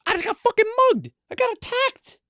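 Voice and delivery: very high indignant squeal; protesting to his friend that this was an attack; outraged squeal. Retold or imitated.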